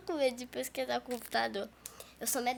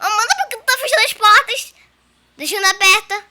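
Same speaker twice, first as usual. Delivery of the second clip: yelling; 7 semitones higher, 13 dB louder than usual. Real, not acted. Yelling about doors.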